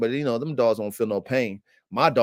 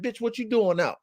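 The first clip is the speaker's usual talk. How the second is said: high-pitched incredulous dog voice; voicing the dogs; 8 semitones higher than usual. Kenneled dogs asking the escapee, high mock-incredulous voice. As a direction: ask it as a scandalized kennel mate. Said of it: "I can read my dogs".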